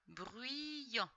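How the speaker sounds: "Bruyant" stretched across both syllables; drawn-out reading. Shown as a demonstration. Teacher putting the syllables together for a pupil, drawn-out "Bruyant". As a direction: blend "Bruyant" slowly, holding the first syllable. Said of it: "ça fait yan".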